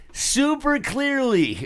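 high, slow teasing answer; mock answer. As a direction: tease him back. Friends answer, laughing.

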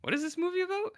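squeaky high-pitched question; mock high voice asking what the movie is about. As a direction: ask it in a silly high voice. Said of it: "And you're like".

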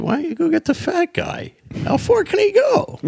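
very high-pitched mock outburst; exasperated retort. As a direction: fire it back at the guard, voice way up. Retold exasperated argument with border guard.

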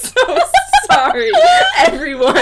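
loud, high, words broken by laughter; helpless real laughter, barely getting words out; laughing apology. Real, not acted. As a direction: apologise through a giggling fit. Apologising while laughing at herself.

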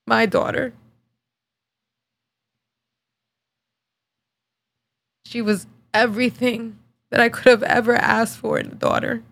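choked up with real grief; fighting back tears, softening as it goes; halting, voice thick with crying; tearful. Real, not acted. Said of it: "my makeup is gonna look like shit".

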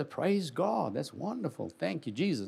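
soft acted gratitude to himself; quiet thankfulness. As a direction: give understated, hushed thanks. Acts reading a welcome letter.

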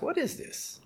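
a high, hushed whisper of wonder; whispered monkey voice; monkey's whisper. Acted. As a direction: breathe it out, curious and amazed. Monkey finds the window frame.

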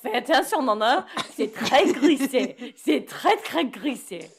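lightly voiced French warning, slow and careful; imitating French host mum. Host mum warning Nonna on icy stairs, in French. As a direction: caution the grandmother in French. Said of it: "she was like".

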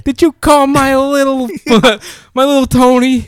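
high-pitched, drawn-out mob wife impression; hammed-up high mob wife voice. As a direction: play a mob wife confronting someone. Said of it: "It's a mob wife".

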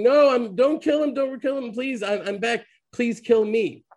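high, loud pleading cry to spare his friend; desperate shout. Retold or imitated. Friend running to the gallows shouts to spare him, high and loud. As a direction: yell out begging them to stop.

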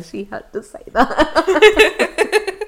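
loud, high, slowed by laughter; laughing. Real, not acted. Teasing the co-host about colleagues listening, laughing. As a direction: tease through big laughter.

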